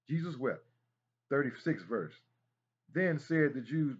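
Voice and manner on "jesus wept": usual speaking voice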